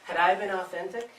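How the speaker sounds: normal talking voice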